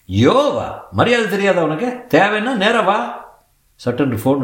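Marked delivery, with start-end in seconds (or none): 0.1-3.0 offended shopkeeper. Acted. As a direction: put the rude caller in his place. Shopkeeper offended by rude caller. (கோபமான கடைக்காரர் குரல்)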